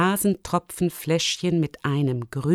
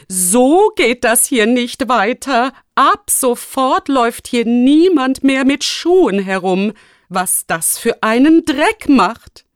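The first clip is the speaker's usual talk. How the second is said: loud, indignant scolding; shouted orders; 5 semitones higher, 6 dB louder, slower than usual. A narrator's reading. Rector banning shoes in the hall, loudly scolding. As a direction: lay down the law, stretching the key words. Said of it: "rief er".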